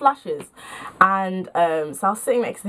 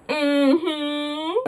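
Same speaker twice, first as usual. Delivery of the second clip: high, rising "mm-hmm"; mock-saucy drawn-out hum; suggestive hum; 5 semitones higher than usual. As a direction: hum knowingly at the innuendo. Suggestive aside at own innuendo.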